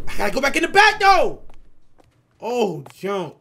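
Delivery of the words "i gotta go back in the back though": panicky retreat. Real, not acted. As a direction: voice rising into a loud wail. Retreating when somebody appears in game.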